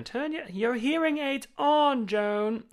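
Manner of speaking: high, slow, loud grand-dame jab; Maggie Smith voice. Retold or imitated. Maggie Smith teasing Joan about hearing aids, high and slow. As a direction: drag out the insult like an old stage dame. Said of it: "she'd be like".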